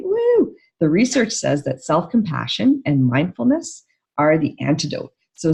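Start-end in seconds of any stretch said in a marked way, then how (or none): 0.0-0.5 a sudden, much higher whoop of real delight; excited woo. Real, not acted. Therapist whoops over research findings, real excitement. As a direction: whoop with joy at the good news.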